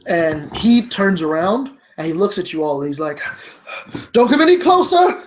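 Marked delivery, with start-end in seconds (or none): 4.1-5.2 high, loud cornered cry; panicked warning. Acted. Waiter turns on the approaching group, panicked warning. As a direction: warn them back, voice pitched way up.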